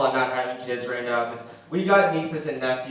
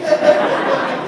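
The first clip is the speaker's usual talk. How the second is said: loud high burst of laughter; real laugh, rising high; big high laugh; 10 dB louder than usual. Real, not acted. Laughs at own joke.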